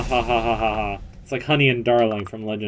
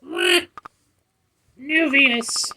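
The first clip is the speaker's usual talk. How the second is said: pet voice; much slower than usual. Acted. Voicing pet character.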